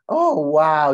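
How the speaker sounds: loud, delighted wow; encouraging excitement. Shown as a demonstration. Mentor humouring a young believer, excited. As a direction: go along with their excitement. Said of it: "You go with the excitement".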